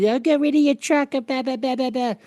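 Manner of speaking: high, sing-song nagging; mocking the naysayers. Everybody tells him to ditch his truck, mocked in a high nag. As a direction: parrot their advice dismissively. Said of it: "everybody goes".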